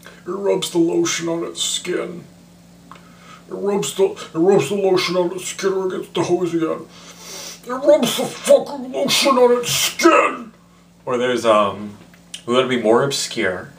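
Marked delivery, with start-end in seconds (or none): 0.0-10.5 high, eerie whispery creep voice building to a yell; menacing serial killer from the pit; Buffalo Bill impression. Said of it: "So there's Buffalo Bill".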